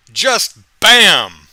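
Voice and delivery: loud impact. Acted. Truck hits the girl.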